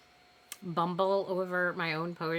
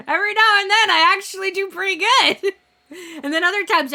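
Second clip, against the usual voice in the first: laughing; 9 semitones higher, 9 dB louder than usual. Real, not acted. Laughing at own stumbles.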